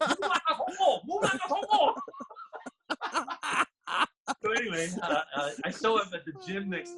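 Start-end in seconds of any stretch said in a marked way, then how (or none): 0.1-1.9 very high mock Thai accent, laughing; imitating Thai coach's accent. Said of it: "the way he said it". 2.0-3.6 high, helpless laugh; laughter. Real, not acted. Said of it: "laughter after the imitation".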